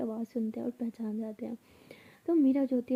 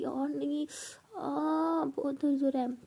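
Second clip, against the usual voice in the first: a higher, pained little cry over the stomach; pained moan; 3 semitones higher, slower than usual. Acted. Avni fakes a bellyache to her friend, pained crying. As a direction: whimper through fake pain, trying to sound hurt. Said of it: "सच में बहुत पेन होना start".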